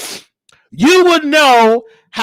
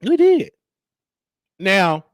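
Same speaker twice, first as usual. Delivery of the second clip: normal talking voice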